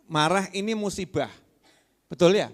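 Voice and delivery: ordinary talk, nothing marked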